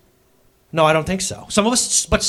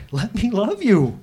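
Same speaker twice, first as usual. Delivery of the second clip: tender plea; 4 semitones higher, slower than usual. Retold or imitated. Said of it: "says God".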